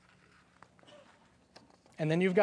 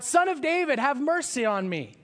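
high, loud desperate cry for mercy; yelling out; 9 semitones higher, 5 dB louder than usual. Retold or imitated. Blind beggar calls out to Jesus over the crowd, shouting. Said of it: "he starts yelling out".

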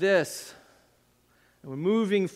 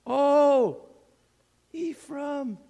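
higher, drawn-out longing call; yearning cry; 6 semitones higher, 3 dB louder, much slower than usual. Acted. Preacher voicing the Lord calling to Ephraim, yearning. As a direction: call out to a lost child. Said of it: "reveal something about the Lord's heart".

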